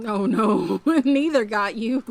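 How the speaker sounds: loud laughing relief. Real, not acted. Friend escapes animals, laughing.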